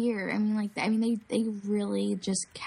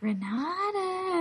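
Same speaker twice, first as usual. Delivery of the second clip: high, drawn-out singsong name call; creepy clown calling; 10 semitones higher, much slower than usual. Retold or imitated. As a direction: imitate a clown taunting by name. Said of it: "they kept calling my name".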